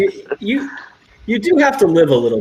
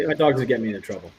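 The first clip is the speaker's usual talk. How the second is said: ordinary delivery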